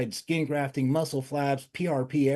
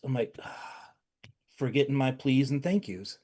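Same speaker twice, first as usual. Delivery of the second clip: usual speaking voice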